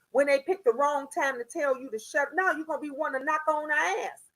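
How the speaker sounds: ordinary talk, nothing marked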